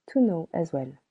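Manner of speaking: ordinary delivery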